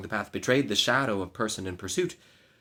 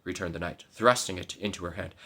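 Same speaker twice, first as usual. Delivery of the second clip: ordinary delivery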